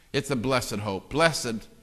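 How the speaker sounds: usual speaking voice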